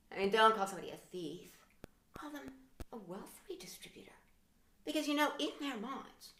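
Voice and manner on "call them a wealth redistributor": hushed, mock-delicate phrasing; a soft conspiratorial whisper; sly whispered euphemism. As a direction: whisper the polite term knowingly.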